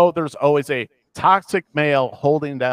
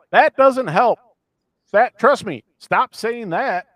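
high, louder retold protest; exasperated pleading; 9 semitones higher, 6 dB louder, slower than usual. Viewer reacting to show creatives' interview, exasperated. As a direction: plead in exasperation, pitch pushed up.

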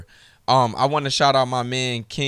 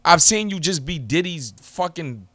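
heated rant; 3 semitones higher than usual. Real, not acted. Angry rant at Mickey Facts.

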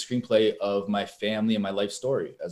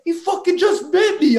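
much higher, louder, shocked complaint to the ref; indignant yelp, pitched way up; outraged disbelief; 8 dB louder than usual. Retold or imitated. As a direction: cry out to the referee in disbelief. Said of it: "I'm like".

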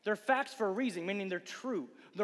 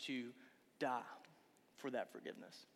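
tender and soft; 6 semitones lower, 7 dB quieter than usual. Retold or imitated. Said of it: "Jesus died to say".

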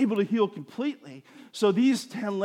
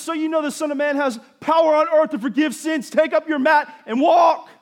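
high, loud commanding shout; Jesus ordering the lame man to walk, shouted; 8 semitones higher, 6 dB louder than usual. Retold or imitated. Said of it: "Jesus says".

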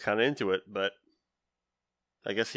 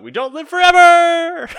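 loud, high-pitched drawn-out yell; yelled battle cry; 11 dB louder than usual. Retold or imitated. Girl jumping off the tower with friends, yelling out. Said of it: "the girl yells out".

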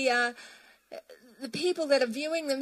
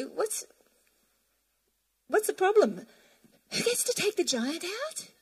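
breezy David; 7 semitones higher than usual. Retold or imitated. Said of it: "He said, basically".

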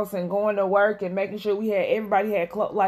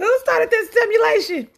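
mock high voice; 12 semitones higher than usual. Mock sci-fi question, joking.

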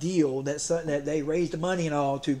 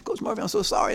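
sweet apology; 5 semitones higher than usual. Retold or imitated. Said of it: "he was so sweet".

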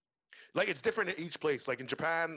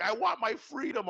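drawn-out, higher-pitched mock cry; mock battle cry; 8 semitones higher, 4 dB louder, slower than usual. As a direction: declare it slowly like a hammed-up rebel. Imitating a fighter's battle cry.

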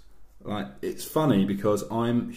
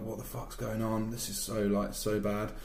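ordinary delivery

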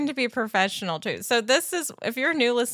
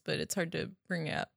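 usual speaking voice

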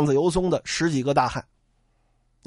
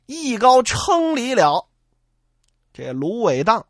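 plain reading voice

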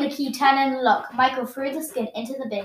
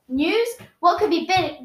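plain reading voice

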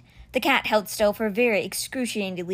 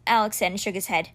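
ordinary delivery, nothing marked

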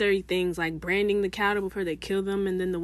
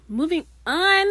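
drawn-out, louder moving on; odd delivery; 9 semitones higher, 7 dB louder than usual. Real, not acted. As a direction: stretch it out theatrically. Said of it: "why I just said it like that".

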